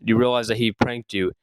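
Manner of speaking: ordinary delivery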